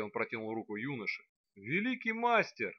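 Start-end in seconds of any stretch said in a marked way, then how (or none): 1.6-2.7 high, drawn-out moan. Acted. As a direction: groan it out in despair. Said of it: "простонал Фейрус". (простонал жалобно)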